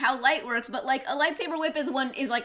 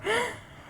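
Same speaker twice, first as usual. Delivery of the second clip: sharp gasp; 9 semitones higher than usual. Real, not acted. Sudden gasp.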